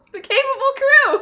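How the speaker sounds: excited recall. Acted. Triumphant name recall.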